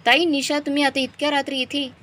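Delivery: high-pitched surprised greeting at the door; startled to see late-night guests. A narrator's reading. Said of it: "आश्चर्याने विचारते".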